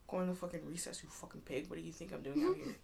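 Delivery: under-the-breath angry retort; muttered comeback. Retold or imitated. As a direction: snap back quietly, mock annoyance. Retorting to teacher in memory.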